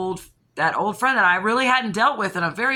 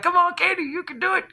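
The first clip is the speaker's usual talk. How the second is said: high cheering encouragement; cheering bugs; 10 semitones higher than usual. Retold or imitated. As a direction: cheer her on like a tiny crowd. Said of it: "They're like".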